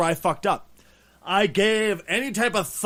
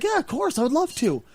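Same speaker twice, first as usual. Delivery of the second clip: put-on child voice; 9 semitones higher than usual. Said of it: "I was like".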